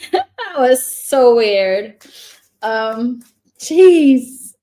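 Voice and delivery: slow, amused words said through a laugh. Real, not acted. Tarot reader laughs at her own slip, laughing. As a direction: laugh it off as you say it.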